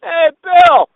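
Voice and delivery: loud, high holler across a room; big imitated call-out; heckler's yell. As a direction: shout it out like the returning heckler. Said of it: "What do I hear?".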